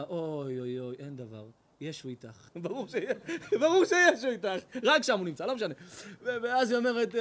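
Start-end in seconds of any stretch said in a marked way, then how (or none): 0.0-2.5 lower, softer cooing comfort; soft low mock priest. The priest soothing the married woman's confession, soft mock comfort. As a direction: soothe her like a sly priest.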